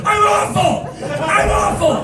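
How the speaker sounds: high, laughing whimper; miserable whine. Retold or imitated. Teller answering his ex, high and whiny. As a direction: whine it like a broken man.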